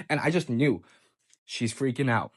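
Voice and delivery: ordinary delivery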